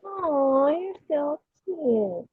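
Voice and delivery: slow, hushed cooing; soft cooing. Real, not acted. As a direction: coo it tenderly. Soft aw cute.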